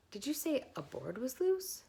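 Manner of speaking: 1 high eager piglet. A narrator's reading. Wilbur asks, hopeful.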